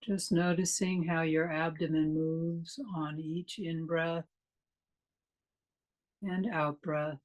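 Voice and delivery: calm, unhurried meditation guide with long pauses; measured meditation delivery, leaving space after each phrase; guided meditation. Real, not acted. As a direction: lead listeners gently into stillness, phrase by phrase.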